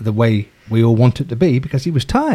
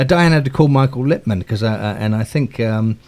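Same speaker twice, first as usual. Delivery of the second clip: ordinary talk, nothing marked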